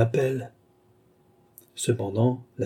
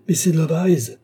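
higher, louder call into the hall; breathless call; 6 semitones higher, 4 dB louder than usual. A narrator's reading. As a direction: call her name, out of breath from running. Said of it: "appelai-je, hors d'haleine".

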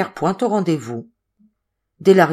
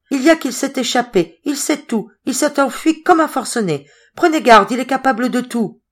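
high, agitated, urgent warning; anguished warning; 8 semitones higher, 5 dB louder than usual. A narrator's reading. Zoé warns the family he has escaped, anguished and urgent. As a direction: warn them in panic, voice pitched up.

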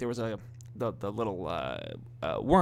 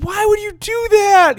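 much higher, louder, slow whine; mock kid whine; 11 dB louder than usual. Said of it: "we'd be like".

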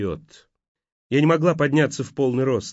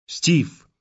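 desperate call; 4 semitones higher than usual. A narrator's reading. Shaking him desperately.

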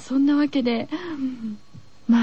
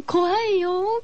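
drawn-out high scared whine; 怖がって伸ばして; 6 semitones higher, much slower than usual. Real, not acted. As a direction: stretch it out, spooked.

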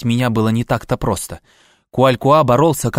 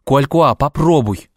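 louder, higher pleading cry; desperate plea; 3 semitones higher, 3 dB louder than usual. A narrator's reading. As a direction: beg him, voice rising. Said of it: "взмолился я".

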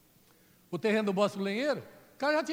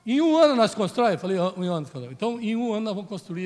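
loud excited retelling; 10 dB louder than usual. Real, not acted. Donor call climax, loud.

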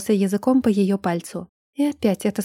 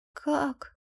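very soft, higher, strained whisper; hoarse whisper; 5 semitones higher, 11 dB quieter than usual. A narrator's reading. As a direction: whisper it as if the voice isn't yours. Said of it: "прошептала она чужим, хриплым тоном".

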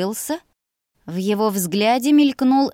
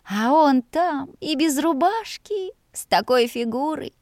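higher-pitched, lingering lovestruck reverie; dreamy; 6 semitones higher than usual. A narrator's reading. Maid picturing the brother without his shirt, dreamy. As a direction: daydream aloud, drawing the words out. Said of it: "мечтательно вздохнула девушка".

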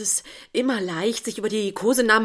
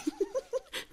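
laugh; 9 dB quieter than usual. Acted. Lacht über Lob.

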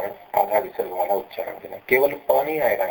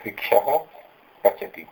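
ordinary delivery

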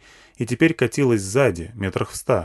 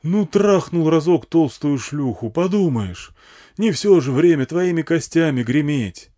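slow, high self-excusing bullhorn shout; drawn-out amplified argument; megaphone yelling; 6 semitones higher, 4 dB louder than usual. A narrator's reading. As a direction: excuse yourself loudly over a loudhailer. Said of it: "орал мегафон на всю пустыню".

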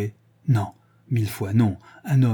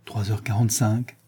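ordinary delivery, nothing marked

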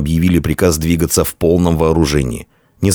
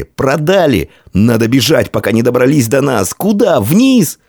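high-pitched alarm, rising to cries; panicked; 7 semitones higher than usual. A narrator's reading. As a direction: soldiers losing their nerve. Soldiers panicking among themselves.

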